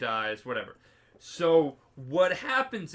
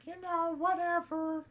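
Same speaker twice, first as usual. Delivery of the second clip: much higher, airy mock voice; dismissive priest. Priest shrugging off the hound, dismissive. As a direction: brush it off in a high put-on voice.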